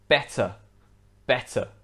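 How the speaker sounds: better with a clear T between the vowels; British T. Shown as a demonstration. Teacher giving the British example, better with a true T. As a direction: say better the British way, T fully sounded. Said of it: "in British English, this word would be pronounced".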